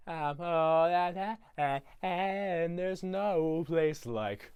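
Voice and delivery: slow, drawn-out, self-satisfied crooning; Grinch voice. Retold or imitated. The Grinch preens alone in his cave, slow and smug. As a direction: savour every word like a smug Grinch. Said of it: "He's just".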